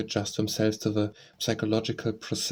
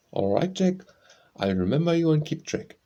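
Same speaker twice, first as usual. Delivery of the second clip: high-pitched character voice for a parting; lightly acted, higher-voiced official goodbye; consul's farewell; 5 semitones higher, 3 dB louder than usual. A narrator's reading. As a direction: send him off in a raised voice.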